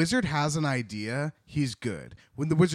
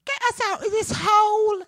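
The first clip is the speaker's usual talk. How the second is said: very high, squeaky little piglet; 5 dB louder than usual. Acted. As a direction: squeal it up high like a tiny pig. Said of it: "Do the piglet voice".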